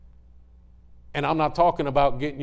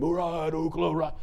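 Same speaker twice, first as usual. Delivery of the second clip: imitated cartoon barbarian battle cry, held back; a half-volume hero's yell; lightly voiced cartoon warrior calling out; mock cartoon scream. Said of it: "And he would scream".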